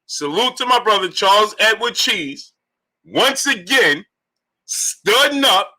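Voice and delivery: high, slow, drawn-out announcer call; hype donation announcement. Acted. Host announcing five hundred in the super chat, drawn-out hype. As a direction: stretch each phrase, holding "hundred" long like a ring announcer.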